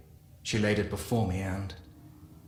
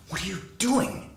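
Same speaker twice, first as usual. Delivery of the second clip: pitched much higher, a mild inner shriek; mock inner scream; 10 semitones higher than usual. A narrator's reading. His own inner voice crying out at him, much higher. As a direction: jump way up as the panicking voice in his head. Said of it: "My own voice screamed in my head".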